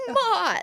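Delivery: high, mock-villain "mwah" cackle; villainous whoop of laughter; evil laugh. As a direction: burst out with a silly evil laugh. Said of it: "That evil laugh".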